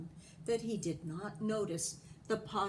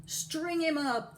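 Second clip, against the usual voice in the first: high, loud, slow threat; men of the town demanding punishment, angry; angry mob shout; 8 semitones higher, 5 dB louder, slower than usual. A narrator's reading. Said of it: "the men of the town shouted".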